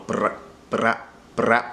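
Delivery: bra, bra, brap with a buzzing trill; rolled r. Shown as a demonstration. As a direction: brap with the r vibrating in the middle. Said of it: "hear the vibration in the middle".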